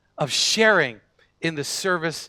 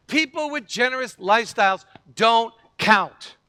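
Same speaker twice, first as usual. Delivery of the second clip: normal talking voice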